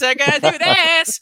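high mock sitcom voice, hammed-up; mocking a co-host's put-on voice, high and hammy; snaps-up guy impression. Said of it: "two snaps up guy from Living Colors when he talked like this".

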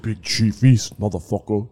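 deep, booming name drop, lightly imitated; big deep rapper voice. Imitating a rapper bursting into a freestyle, big deep voice. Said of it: "He's got the big, deep voice".

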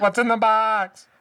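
high, loud, drawn-out movie-quote yell; anguished mock shout. Quoting Seven after creepy bathroom story, anguished shout. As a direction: cry it out in hammed-up desperation.

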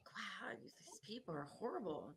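unvoiced whisper, quietly scandalised; whispered aside. Bartender whispering about the rude customers. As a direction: whisper it under your breath. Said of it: "I was like".

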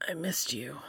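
soft unvoiced whisper. A narrator's reading. Dean whispering to Cass in the dim sickroom, soft whisper. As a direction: breathe it out to Cass.

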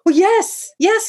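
very high, loud alarmed cry; yelling. A narrator's reading. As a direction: yell it out at the top of your range. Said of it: "he yelled".

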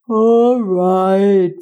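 lightly voiced giant; a hurting giant agreeing. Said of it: "said the giant".

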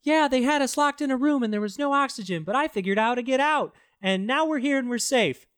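high-pitched mock scientist voice; scientist's line. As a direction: recite it high, imitating the scientist. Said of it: "one of the scientists is like".

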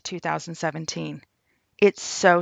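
normal talking voice

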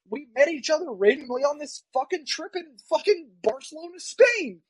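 high-pitched incredulous recap; retold amazement. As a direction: build to a high peak on Spain. Retells amazed reply to friends.

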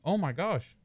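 ordinary delivery

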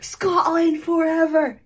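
loud, high, drawn-out bad Scottish accent; horrible Scottish accent. Actor recreating a deleted joke take, horrible Scottish accent. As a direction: belt it out in a deliberately terrible Scottish accent. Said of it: "absolutely horrible Scottish accent".

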